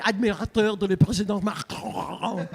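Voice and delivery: hammed-up French, stretched final word; gushing mock French. Imagining meeting Macron, hammed-up French admirer. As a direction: greet grandly in exaggerated French. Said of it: "I'm gonna be like".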